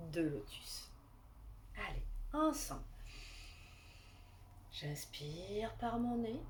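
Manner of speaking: calm guided relaxation voice; guided meditation. Acted.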